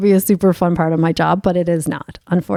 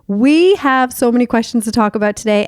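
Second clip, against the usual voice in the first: ordinary talk, nothing marked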